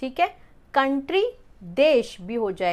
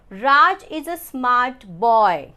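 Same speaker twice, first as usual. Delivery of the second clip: each word slow and separate, a bit louder; slow read-out; 4 dB louder, much slower than usual. Shown as a demonstration. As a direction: read the example sentence slowly for the class. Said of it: "जैसे मैं पहला sentence पढ़ती हूँ". (धीमे, ज़ोर से वाक्य पढ़ना)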